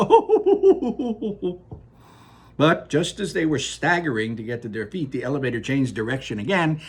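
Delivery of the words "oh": high, loud hearty laugh; roaring laughter. Acted. Wonka laughing at the tumbling passengers, loud roaring laugh. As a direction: let a big belly laugh roll out. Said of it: "roaring with laughter".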